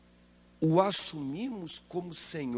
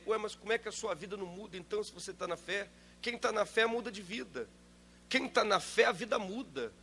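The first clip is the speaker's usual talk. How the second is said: ordinary talk, nothing marked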